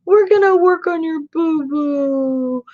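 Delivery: put-on husband sweet-talking the hurt German Shepherd in baby talk; baby talk to dog. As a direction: coo at the dog like a toddler. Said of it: "He just tells him".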